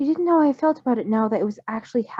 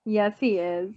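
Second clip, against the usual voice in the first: ordinary delivery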